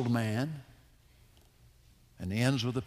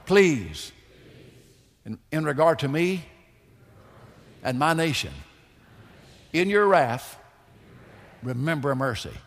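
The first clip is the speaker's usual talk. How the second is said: louder, measured call-and-response prayer; leading a prayer; 6 dB louder than usual. Shown as a demonstration. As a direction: lead the class line by line in prayer. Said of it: "Say after me, class".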